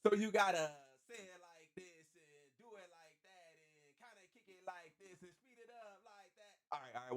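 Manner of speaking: barely audible rehearsal under the breath; muttering to himself. Retold or imitated. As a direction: mumble the notes so you remember them. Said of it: "mutters the instructions to himself".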